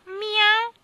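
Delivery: high, loud mewing meow; sharp high cat cry. Acted. Cat meows.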